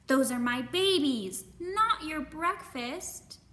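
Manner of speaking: mother robin scolding bear. Acted. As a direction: spell it out to the greedy bear.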